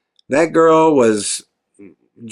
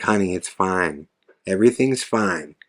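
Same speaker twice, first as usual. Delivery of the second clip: low, soft imitated Southern woman; gentle attempt at a Southern accent; 6 semitones lower, 6 dB quieter than usual. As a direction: reassure in a light Southern accent. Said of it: "soft Southern accent".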